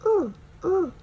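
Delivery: ooh pushed forward, high and loud; ooh sound. Shown as a demonstration. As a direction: lips forward, voice out. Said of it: "you push your voice forward and out".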